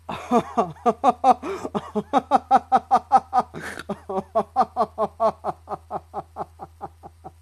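long, loud burst of helpless laughter; uncontrollable laughter. Real, not acted. Speaker overcome by giggles, uncontrollable laughter. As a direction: laugh hard and let it slowly tail off. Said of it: "I can't stop laughing".

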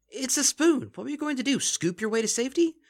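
high, loud disbelief; incredulous Lyra. Acted. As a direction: scoff at the spoon. Lyra mocking the spoon.

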